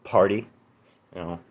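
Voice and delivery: ordinary talk, nothing marked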